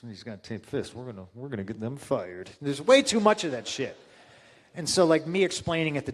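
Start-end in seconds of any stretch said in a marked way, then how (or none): 0.1-2.5 low, soft mock grumble; mock grumbling. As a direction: mutter it like a petty manager. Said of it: "instead of being like".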